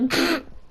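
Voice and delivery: short high laugh slipping out; real giggle breaking through; stifled laugh burst. Real, not acted. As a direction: laugh you can't hold back. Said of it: "trying not to laugh while talking".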